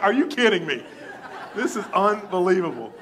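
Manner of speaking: loud, high laughing disbelief; marvel, laughing; astonished, laughing exclamation; amazed laughter. Retold or imitated. Amazed reaction, retold.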